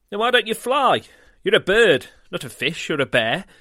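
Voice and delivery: loud, high gull voice; bossy seagull. Acted. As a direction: tease him like a brash gull. Gull scolds Goose.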